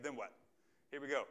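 ordinary talk

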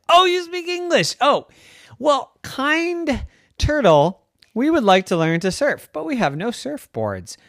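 put-on high, loud sweet kid voice; polite kid. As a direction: ask nicely like a hopeful boy. Lucas politely asks turtle.

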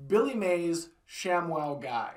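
ordinary delivery

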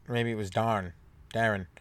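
ordinary talk, nothing marked

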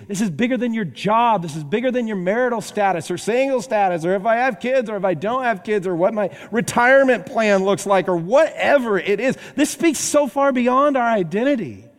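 rising preacher's fervor; fiery preaching. Acted. Preaching builds to full force.

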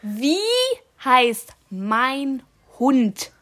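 very slow, loud, overarticulated; slow exaggerated mouthing. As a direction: stretch each word so she can lip-read. Mouthing slowly for lip-reading.

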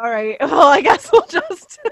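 loud, laughing, trailing off in embarrassment; awkward mock reply. Host imagining her own awkward escape from a foreign star. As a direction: fumble the words, giggling. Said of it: "I would just be like".

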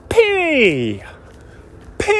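high, drawn-out "pee" spit noise; imitated spitting. Host demonstrating his baseball spit, imitated spitting noise. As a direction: mimic a spit flying with a long high squeal. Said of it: "and then I go".

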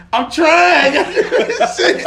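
high, loud whine through laughter; laughing, high-pitched mock exasperation; flustered protest. Acted high, flustered reply to partner.

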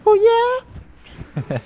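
very high teenage rock-singer imitation. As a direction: squeak the singer's catchphrase like a pubescent boy. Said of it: "when he was in puberty".

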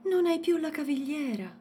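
put-on high child voice, exclaiming. A narrator's reading. Youssef exclaiming to his mother, high child voice. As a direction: blurt it out like a little boy. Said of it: "esclamò Youssef".

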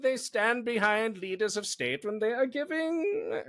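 a clumsy, hammed-up German accent; deliberately bad German accent for a laugh. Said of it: "I'm doing a German accent for Russians. It's terrible".

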